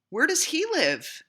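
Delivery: high, loud little beaver asking; eager question. A narrator's reading. Little Beaver asks the wise beaver, high and eager. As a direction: ask with bright curiosity.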